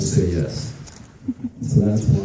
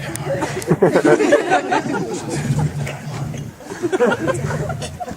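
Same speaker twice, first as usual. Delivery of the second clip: breathy laugh. Real, not acted. Laugh after beef stew joke.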